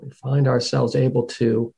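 calm meditation guide, long pauses between phrases; guided meditation. Real, not acted. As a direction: guide them gently inward, leaving space after each phrase.

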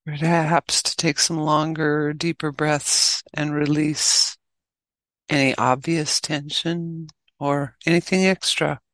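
measured meditation guide with long pauses; unhurried instructions, leaving long silences; guided meditation. Acted. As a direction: guide them gently into stillness, phrase by phrase.

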